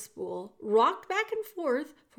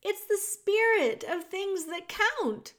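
louder, much higher, slowed repeat; shouting; 10 semitones higher, 5 dB louder than usual. A narrator's reading. Princess repeating loudly for hard-of-hearing Meg. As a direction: shout it slowly so she can hear. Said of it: "shouted the P".

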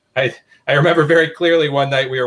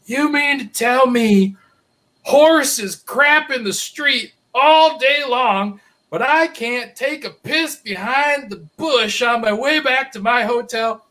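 high, slow, drawn-out indignant protest; hammed-up drunk guy mouthing off to a state trooper; tipsy buddy protesting; 11 semitones higher, much slower than usual. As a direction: whine it high and slow like a drunk arguing with a cop. Drunk buddy talking back to cop.

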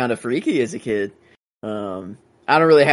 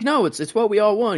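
higher mock dad voice; dad pleading; 10 semitones higher than usual. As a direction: plead like a desperate father. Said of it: "his dad's like".

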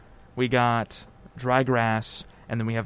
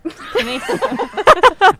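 loud laugh; 10 dB louder than usual. Real, not acted. Bursts out laughing.

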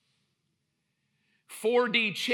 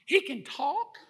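mock surprise; 6 semitones higher, 5 dB quieter than usual. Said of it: "She's not like".

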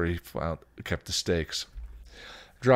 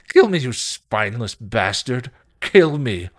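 loud, desperate, higher-pitched shout; screamed demand; 5 semitones higher, 9 dB louder than usual. Acted. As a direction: yell it as a furious plea to die. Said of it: "he screamed".

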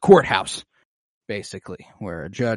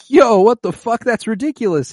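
very high, loud mock outrage; outraged protest; 12 semitones higher, 10 dB louder than usual. Defendant reacts to six years in jail, outraged. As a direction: burst out in exaggerated disbelief, pitched way up.

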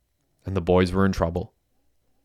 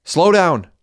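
high, raised command; pitched-up shout over the engine; urgent order; 9 semitones higher, 4 dB louder than usual. A narrator's reading.

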